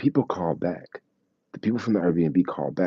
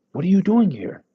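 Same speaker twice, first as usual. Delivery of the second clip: high, loud guard demanding an answer; imitating a suspicious guard's challenge; mock stern guard; 13 semitones higher, 6 dB louder than usual. Security guard confronts him.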